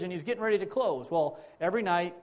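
usual speaking voice

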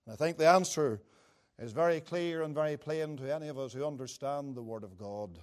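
ordinary talk

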